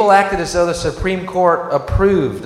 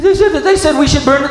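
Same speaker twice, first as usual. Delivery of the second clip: frantic; 7 semitones higher, much faster than usual. Retold or imitated. Mocking panicked people.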